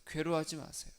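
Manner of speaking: ordinary talk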